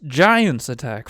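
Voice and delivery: loud, drawn-out "giants"; dramatic announcement. Real, not acted. Game master springing an attack on players, loud dramatic announcement. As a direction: announce the attack slowly and loudly.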